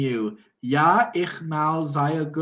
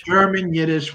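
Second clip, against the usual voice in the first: ordinary talk, nothing marked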